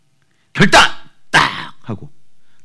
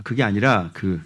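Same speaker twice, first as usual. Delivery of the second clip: ordinary talk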